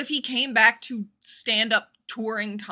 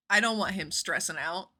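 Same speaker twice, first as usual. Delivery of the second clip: ordinary talk, nothing marked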